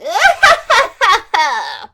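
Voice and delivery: high, loud evil cackle; the mean fairy cackling as she vanishes; gleeful cackle. Acted. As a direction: cackle with triumph. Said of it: "Nightshade cackled gleefully".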